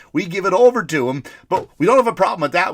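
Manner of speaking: ordinary talk, nothing marked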